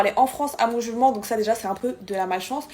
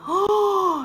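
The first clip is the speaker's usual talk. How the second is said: high, sudden shocked Oh; shocked gasp; 10 semitones higher, 4 dB louder than usual. Retold or imitated. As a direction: gasp as if the heart stops. Check-in closed, heart attack.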